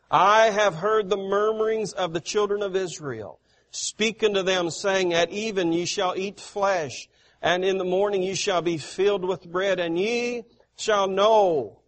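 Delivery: loud, declaiming preacher voice, easing off at the end; forceful scripture reading. Acted. Preacher reading God's words to the congregation, loud and declaiming. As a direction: proclaim God's words from the pulpit.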